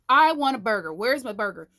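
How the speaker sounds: mock a kid's burger tantrum; whining tantrum. Mother imitating her son's tantrum, mock whining.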